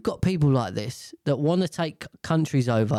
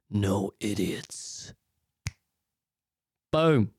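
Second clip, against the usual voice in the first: mock villain decree; 7 semitones lower, much slower than usual. Gauntlet decree replayed.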